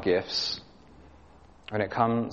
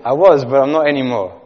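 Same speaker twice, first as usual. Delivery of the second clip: a hint of a higher child voice, louder; lightly voiced little boy; 5 semitones higher, 11 dB louder than usual. Pastor repeating the boy's happy answer in a lightly raised child voice. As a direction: lift the voice a touch like a small boy. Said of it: "I can't really do his high-pitched voice".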